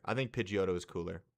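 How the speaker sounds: normal talking voice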